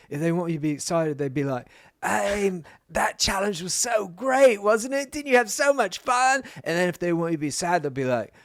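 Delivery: high, sing-song mock Australian producer voice; Australian accent. Retelling how Jowsey mimics producers, in a mock Australian accent. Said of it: "That was my best Australian".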